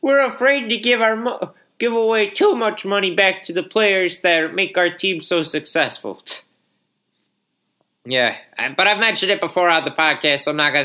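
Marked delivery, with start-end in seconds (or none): 0.0-6.2 whiny rich owner. Retold or imitated. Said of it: "the owners that are all".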